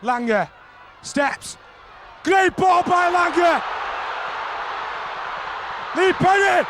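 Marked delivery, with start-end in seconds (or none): none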